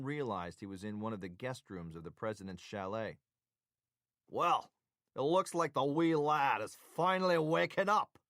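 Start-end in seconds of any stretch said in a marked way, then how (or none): 4.3-8.2 high, loud put-on Scottish brogue; mock Scottish accent. A narrator's reading. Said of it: "came a voice in a mock Scottish accent".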